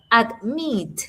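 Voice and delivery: "Admit" said slowly, with the force on MIT; "Admit" as ad-MIT, the end falling; stress on second syllable. Shown as a demonstration. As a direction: lean hard on the last syllable of "Admit". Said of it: "sílaba que tiene el mayor stress".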